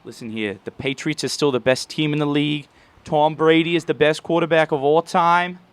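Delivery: loud, brash Boston sports-fan voice; Boston accent. Retold or imitated. A fan defending the Patriots, in a loud Boston accent. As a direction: rant like a die-hard Boston fan. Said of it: "Can I do a good Boston accent".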